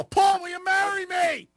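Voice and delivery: high, slow shouted proposal from the back; mock heckle shout. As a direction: yell it across the room like a heckler. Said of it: "I'll yell".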